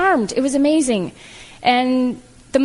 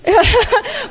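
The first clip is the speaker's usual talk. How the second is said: short laugh; 11 semitones higher, 4 dB louder than usual. Real, not acted. Laughing at the irony.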